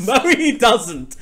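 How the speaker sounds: laughing through the denial. Real, not acted. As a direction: protest breaking into laughter. Repeats denial while laughing.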